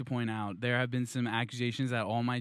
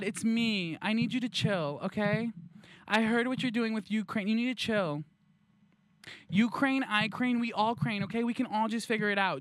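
mock-earnest begging, pitched up; pleading empath; 7 semitones higher than usual. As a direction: talk down a friend with over-sincere urgency. Empath begs imagined Putin to chill.

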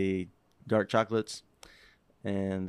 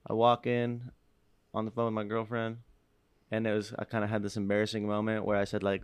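ordinary talk

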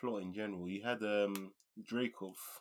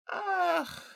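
long, high-pitched groan; loud strained sigh of reluctance; exasperated groan; 6 dB louder than usual. Real, not acted. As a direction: let out a loud drawn-out "ahh". Exasperated noise before admitting disappointment.

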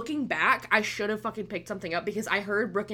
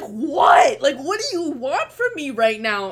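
loud, raised exasperated outburst; 4 semitones higher, 8 dB louder, slower than usual. Retold or imitated. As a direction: snap back loudly, retelling being pestered. Retells exasperated reply to shouting.

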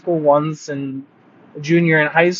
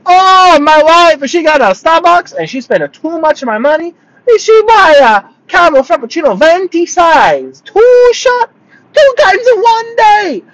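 loud, high-pitched, hammed-up Vietnamese accent; mock Vietnamese accent; 13 semitones higher, 6 dB louder than usual. Uber driver griping about wife's Starbucks spending, mock Vietnamese accent. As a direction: imitate an outraged husband in exaggerated Vietnamese-accented English, building to a yell. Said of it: "they talk like this".

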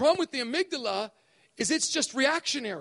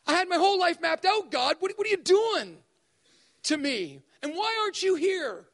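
normal talking voice

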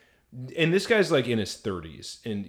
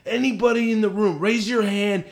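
high, loud druggy rant; Florida Man; 10 semitones higher, 7 dB louder than usual. Retold or imitated. Imitating a interviewee calling out to the room, druggy voice. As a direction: holler it like a wired druggie interrupting. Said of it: "he spoke kinda like this".

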